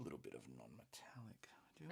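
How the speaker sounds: hushed whisper, breathy and soft. Real, not acted. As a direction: lean in and whisper the plan. Quiet aside while painting.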